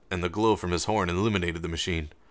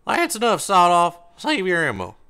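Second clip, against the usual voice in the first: high, loud order across the distance; commanding call; 11 semitones higher, 8 dB louder than usual. Acted. Cracker calls his partner off the bot, loud command. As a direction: cut him off with a sharp command. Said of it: "Cracker's voice cut the distance".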